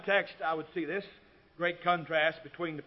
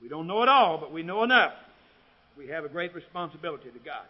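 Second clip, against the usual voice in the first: normal talking voice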